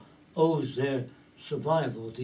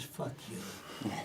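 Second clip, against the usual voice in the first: whispered, much softer fuck you; whispered dismissal; 4 semitones lower, 13 dB quieter than usual. Retold or imitated. God bored by old news, dismissive.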